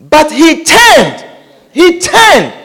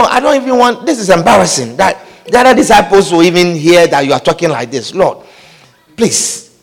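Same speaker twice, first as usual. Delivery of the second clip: a hint of a low, concerned friend pulling him aside; quietly imploring, as a worried friend; softer, private plea, lightly acted; 8 semitones lower, 3 dB quieter than usual. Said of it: "like very concerned person".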